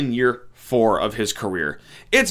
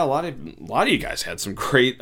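usual speaking voice

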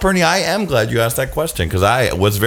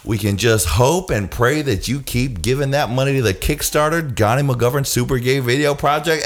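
ordinary talk, nothing marked

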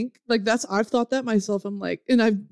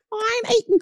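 high, hammed-up British waif; begging orphan; 12 semitones higher than usual. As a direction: plead like a pitiful orphan, very high. Guest pleading as orphan.

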